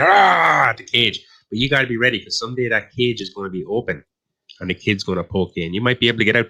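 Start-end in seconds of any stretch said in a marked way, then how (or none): 0.0-0.7 mock bear roar. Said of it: "And the bear's like".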